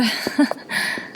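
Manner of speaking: soft, breathy little laugh; soft chuckle. Acted. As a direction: chuckle quietly to yourself. Polite chuckle in acted scene.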